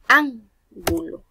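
"Ángulo" with ÁN loud and stretched; slow "Ángulo", leaning hard on ÁN; stress on first syllable. Shown as a demonstration. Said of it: "en la I".

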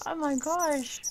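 high, loud exclamation; amazed. Real, not acted. Player amazed by a huge tree, exclaiming. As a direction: exclaim it in amused disbelief.

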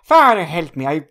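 a hint of a desperate Irishman pleading; frantic Irishman. Retelling the Irishman begging the priest, mild panicked plea. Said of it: "And he says, like".

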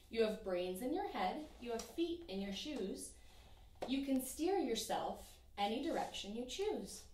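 plain reading voice

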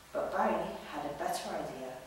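plain reading voice

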